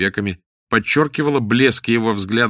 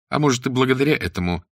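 ordinary delivery

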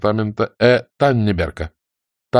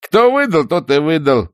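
insolent brush-off; 7 semitones higher, 5 dB louder than usual. A narrator's reading. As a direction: draw it out cheekily, pitched up. Soldier brushes off the question. (нагло, нараспев)

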